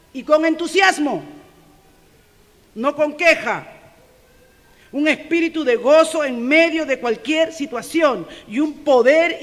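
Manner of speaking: loud, slow, emphatic preaching; fiery sermon voice; forceful preaching. Acted. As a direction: preach it out with full force to the church.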